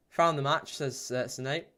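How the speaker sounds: normal talking voice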